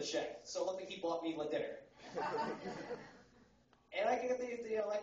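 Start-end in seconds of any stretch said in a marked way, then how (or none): 2.0-3.1 breathy unvoiced chuckle; airy laugh. Real, not acted. Comic laughing after the check joke, airy chuckle. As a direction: let a breathy laugh slip.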